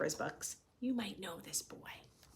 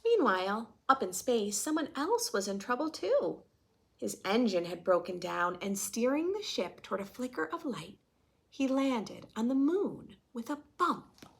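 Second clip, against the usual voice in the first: storytelling voice; 4 semitones higher than usual. A narrator's reading. Reading picture book to class.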